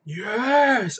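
high, loud cry of joy; triumphant yes. Retold or imitated. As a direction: cheer it like you just got freed. Imitating Sakaguchi's joy at being fired.

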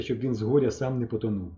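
usual speaking voice

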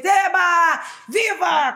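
high, loud mock cheer. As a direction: cheer like a delighted child. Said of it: "Ela fez". (comemoração aguda e alta)

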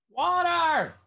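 very high, slow, drawn-out plea; lizard lady voice. Acted. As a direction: stretch it out like a thirsty little lizard.

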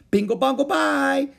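very high, loud chant; shouted. A narrator's reading. Howie shouting nonsense to make noise. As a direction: yell nonsense just for noise. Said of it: "they screamed at the tops of their voices".

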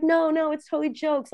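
high-pitched imitation of a friend; dismissive protest. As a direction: brush it off in a high mock voice. Imitates friends brushing off her warning.

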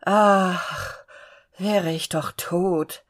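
ein müder, langsamer Seufzer; lebensmüde hinseufzen, gedehnt; seufzend. A narrator's reading. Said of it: "seufzte sich hinstreckend". (leicht seufzender Todeswunsch)

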